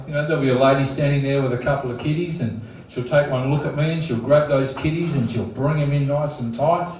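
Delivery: ordinary delivery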